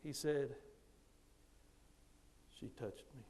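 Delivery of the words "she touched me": very soft, low imitated confession; quiet, shy boy. Shy student explains to teacher, quiet and bashful. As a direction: barely let it out, soft and low. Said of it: "Real quietly".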